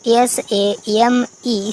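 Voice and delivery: letters ए एम ई spelled one by one, slowly; slow, deliberate letter-by-letter spelling. Shown as a demonstration. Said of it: "fame means प्रसिद्ध".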